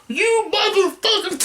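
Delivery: high screeched curse; mock scream. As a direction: scream it at the killer. Voicing Chewie's scream.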